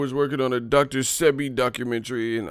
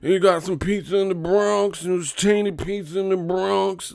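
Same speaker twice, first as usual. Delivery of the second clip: much higher, loud sing-song mock voice; mocking commenter; 10 semitones higher, 6 dB louder than usual. Mocking commenters' pizza excuse, much higher sing-song mock. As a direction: lilt it like a know-it-all idiot. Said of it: "I feel like I lose".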